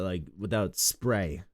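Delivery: usual speaking voice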